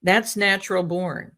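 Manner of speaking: ordinary delivery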